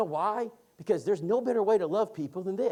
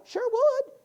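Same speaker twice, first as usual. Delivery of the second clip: put-on old lady voice; 7 dB louder than usual. Voicing Bettina agreeing.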